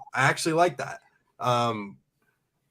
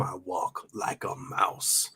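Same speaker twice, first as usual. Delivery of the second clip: slow hushed whisper; 5 semitones lower, 7 dB quieter, much slower than usual. Acted. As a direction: whisper it like sneaking. Line about sneaking like mouse.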